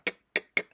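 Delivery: "k, k, k" as breathy, voiceless k bursts; whispered k sound. Shown as a demonstration. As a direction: tap out the bare "k" sound, whispered. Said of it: "que termina en K".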